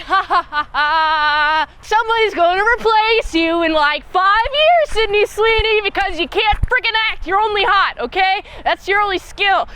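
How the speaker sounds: sneering, taunting ridicule; mocking laugh. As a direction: jeer at the actress. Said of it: "I laugh at her acting".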